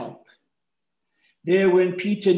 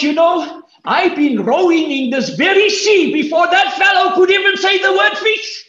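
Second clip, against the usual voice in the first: higher, louder, bristling shout; indignant Peter; 11 semitones higher, 5 dB louder than usual. Retold or imitated. Peter bristles at the stranger's advice, indignant shout. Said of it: "He must have thought".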